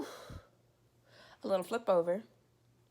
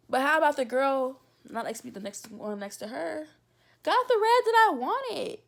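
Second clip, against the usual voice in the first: high indignant complaint; indignant outburst; 5 semitones higher, 9 dB louder than usual. Real, not acted. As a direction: let the outrage spill out as you ask.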